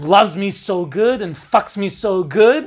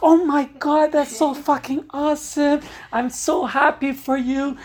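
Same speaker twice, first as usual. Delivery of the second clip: much higher, squealing joy; overjoyed congratulations; 9 semitones higher than usual. Retold or imitated. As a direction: squeal it out for her. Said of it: "And I'm like".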